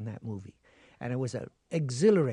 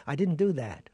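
ordinary talk, nothing marked

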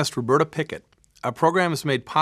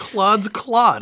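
"Claude's Claude" quicker, higher and louder; delighted second go at "Claude's Claude", raised in pitch; tongue-twister retry; 9 semitones higher, 7 dB louder than usual. Real, not acted. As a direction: punch out "Claude's Claude" high and loud. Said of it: "saying it is almost as hard as playing it".

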